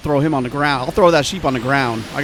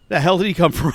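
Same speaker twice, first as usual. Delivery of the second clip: laughing off fear; 3 semitones higher than usual. Real, not acted. After the fright.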